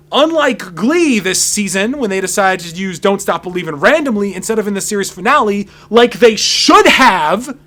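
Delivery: loud, high and building to a shout; emphatic rant. Real, not acted. Host ranting to viewers about Glee's finale, loud and emphatic. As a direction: hammer home the complaint.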